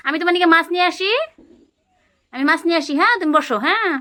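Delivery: put-on high, bright play voice for a small child; playful toddler-talk. Mother playing house with her toddler, high coaxing voice. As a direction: coax the little one along in pretend play. Pretend shopping game with toddler.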